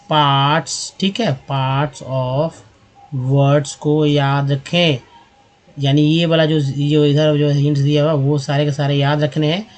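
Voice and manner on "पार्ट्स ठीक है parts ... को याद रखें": very slow, drawn-out words; slow dictation. Shown as a demonstration. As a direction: stretch it out as if writing along. Slow dictation while writing. (धीरे-धीरे लिखते हुए बोलना)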